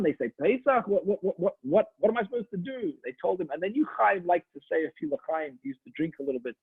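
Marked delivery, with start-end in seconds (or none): 0.4-2.9 flustered panic. Acted. Chaim panics about Pesach.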